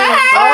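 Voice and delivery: high, loud crying shriek; frightened scream. Acted. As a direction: scream in terror. (tili sa takot)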